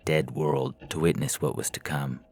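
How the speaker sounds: usual speaking voice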